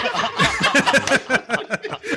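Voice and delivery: long, high-pitched laughing fit; helpless burst of real laughter. Real, not acted. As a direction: crack up at being caught out. Cracking up after being teased.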